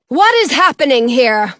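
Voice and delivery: loud, demanding question; calling out. A narrator's reading. The queen arrives at the cottage, calling out. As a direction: call it out with authority. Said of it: "a woman's voice call out".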